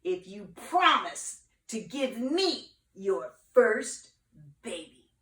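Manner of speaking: slow, loud then dropping witch voice; witch's bargain. Acted. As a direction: draw it out, then drop on baby. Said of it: "said the wicked witch".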